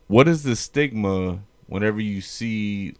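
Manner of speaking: normal talking voice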